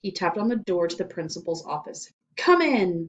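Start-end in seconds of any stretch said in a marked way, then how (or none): 2.4-3.1 loud bellowed command; bellowing. A narrator's reading. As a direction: bellow it at the door. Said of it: "bellowed Mister Kidswater".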